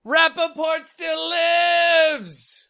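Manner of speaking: high, very slow shout; triumphant slow proclamation. Acted. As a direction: proclaim it like a battle cry. Show sign-off catchphrase.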